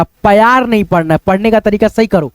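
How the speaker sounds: ordinary talk, nothing marked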